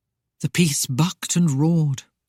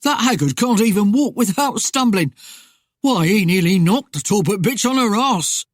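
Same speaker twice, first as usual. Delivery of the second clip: a hint of a jeering ruffian, pitched up; lightly voiced amused highwayman, higher than usual; laughing highwayman; 4 semitones higher, 4 dB louder than usual. A narrator's reading. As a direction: toss it out as a mildly mocking rogue. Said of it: "he laughed".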